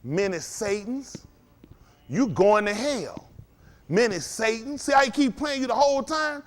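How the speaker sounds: ordinary talk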